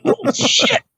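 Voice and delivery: very high mock gasp of words; imitated astonishment. Classmate hearing he brought a woman, high mock astonishment. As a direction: squeak it in stunned disbelief.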